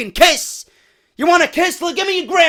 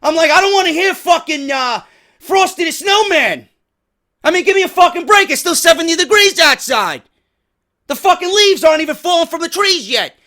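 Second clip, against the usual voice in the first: loud, fed-up ranting with shouted peaks; angry rant; 4 semitones higher, 5 dB louder than usual. Real, not acted. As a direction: vent at Christmas music like you've had enough. Furious rant about Christmas songs.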